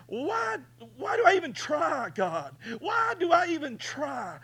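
high, loud anguished pleading; crying out to God. Retold or imitated. Preacher retelling his desperate prayer in the yard, anguished high cry. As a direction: wail your despair up to heaven. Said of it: "And I'm saying".

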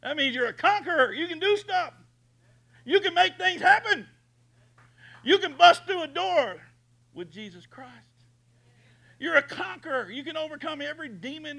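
high shouting pulpit climax; booming motivational speech. Acted. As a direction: shout it to the rafters.

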